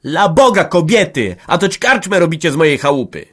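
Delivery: loud, high scolding; sharp reprimand. Acted. Hanka scolding both brawling women, loud. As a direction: stop them sharply. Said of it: "ostro powstała na obie".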